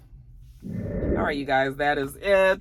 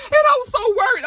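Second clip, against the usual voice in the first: much higher, louder hammed-up wail; mock wail; 6 dB louder than usual. [name] to Tommy, loud hammed-up wail. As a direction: wail it out, overacted.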